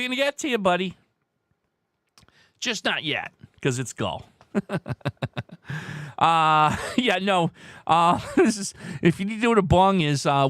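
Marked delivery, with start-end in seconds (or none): 4.5-6.1 soft real chuckle. Real, not acted. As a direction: chuckle quietly to yourself. Amused after the gull answer.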